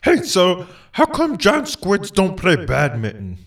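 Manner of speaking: normal talking voice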